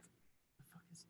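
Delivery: unvoiced confused whisper; a cast member whispering in confusion; whispered curse. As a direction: whisper it under your breath on stage. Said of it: "we're like".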